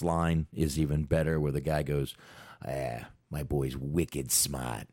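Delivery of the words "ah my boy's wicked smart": slow, imitated Boston drawl; Boston accent. Host quoting the movie's last line, in an imitated Boston accent. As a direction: quote it slowly in a Boston accent. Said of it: "I love these accents".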